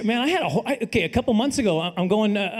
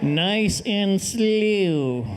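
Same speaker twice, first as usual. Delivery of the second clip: keys handed over nice and slow; stretched, careful, slow-motion words; much slower than usual. Retold or imitated. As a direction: draw every word out like moving in slow motion. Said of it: "hand him the keys slowly".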